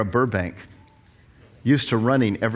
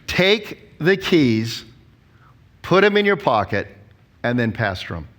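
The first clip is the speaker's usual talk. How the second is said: slow key phrase; slower than usual. Shown as a demonstration. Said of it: "Here's the phrase".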